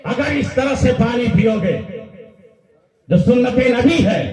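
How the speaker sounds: high, rising preacher's fervour; fervent preaching climax. Real, not acted. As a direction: lift the crowd with rising passion. (ऊँची आवाज़ में जोशीला उपदेश)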